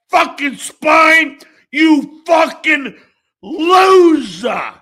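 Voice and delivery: high, loud enraged yell at a traitor; furious shout. Real, not acted. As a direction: scream it at him, slow and furious. Furious rant at ex-cohost.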